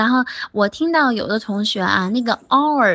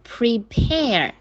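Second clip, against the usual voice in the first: pre-PARE, slow, with the end punched; light "pre", strong long PARE in "prepare"; stress on second syllable. Shown as a demonstration. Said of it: "都是重音在后面".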